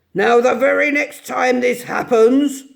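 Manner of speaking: loud, grumbling old badger voice; a disturbed badger warning at the door; gruff badger. Badger grumbling at late visitors, gruff and loud. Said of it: "said a gruff voice".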